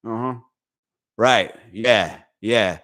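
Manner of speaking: low, dead-serious intensity; intense focused listening. Retold or imitated. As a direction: lock in so hard it looks like a threat. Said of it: "It looks like I'm gonna beat somebody up".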